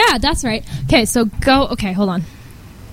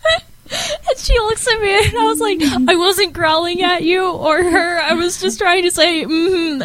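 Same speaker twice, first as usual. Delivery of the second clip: laughing while telling; 8 semitones higher than usual. Real, not acted. Giggling to her co-host about the embarrassing growl, laughing. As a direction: laugh helplessly as you retell it.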